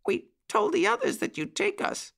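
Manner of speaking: very high, small puppy voice; soft puppy bark. A narrator's reading. Petey admits they told the others, soft high puppy voice. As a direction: confess it in a tiny high voice. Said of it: "softly barked Petey".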